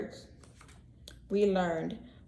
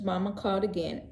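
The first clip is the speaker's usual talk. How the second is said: ordinary delivery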